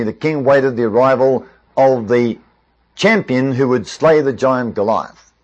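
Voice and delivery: normal talking voice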